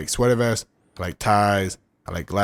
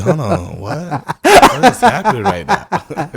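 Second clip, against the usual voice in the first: loud, high, slow through laughter; real laughing disbelief; 9 semitones higher, 10 dB louder, much slower than usual. Real, not acted. Friend lost in his own absurd bit, helpless laughing disbelief. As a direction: cry it out while cracking up.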